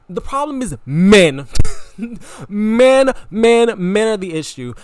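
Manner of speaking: loud declaration. Real, not acted. Punchline announced loudly.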